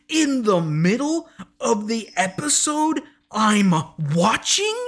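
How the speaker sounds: mock outrage at a mid-episode trailer; incredulous rant. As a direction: rant at the show in disbelief. Ranting at the show.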